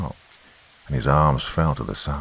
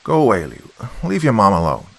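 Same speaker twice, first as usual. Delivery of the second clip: irritated father, louder and raised; father's voice; 5 semitones higher, 4 dB louder than usual. A narrator's reading. Father sends son away through the door, raised voice. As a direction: send the boy off, raised voice. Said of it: "his father's voice answered".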